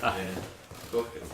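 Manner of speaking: ordinary talk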